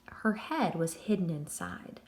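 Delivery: ordinary delivery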